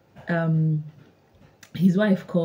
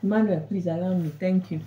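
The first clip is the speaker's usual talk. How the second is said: ordinary delivery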